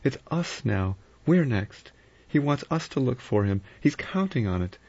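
high, pleading alarm; crying out. A narrator's reading. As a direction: plead urgently, pitched right up. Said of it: "She cried".

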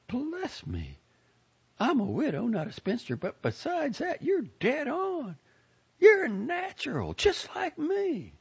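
put-on high, gushing old lady voice; a proud, fussy Southern lady; chatty old lady. A narrator's reading. Lady character voice.

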